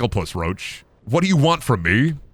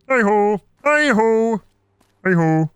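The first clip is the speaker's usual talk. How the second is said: high puppet-frog call; Kermit; 6 semitones higher, slower than usual. Acted. Streamer searching for the Kermit pitch, frog impression. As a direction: try out the frog's pitch. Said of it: "percolate the pitch of that son of a bitch".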